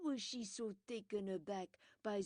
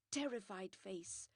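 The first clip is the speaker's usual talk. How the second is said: usual speaking voice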